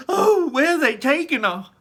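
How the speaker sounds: high, loud frantic shriek. Acted. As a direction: shriek in panic. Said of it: "shrieked Missus Salt".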